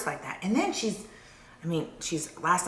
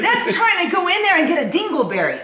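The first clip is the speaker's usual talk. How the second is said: laughing; 10 semitones higher, 4 dB louder, faster than usual. Real, not acted. Laughing while mocking.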